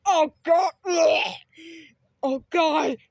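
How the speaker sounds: loud gagging disgust. Acted. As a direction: react like it tastes awful. Kid tasting bad food.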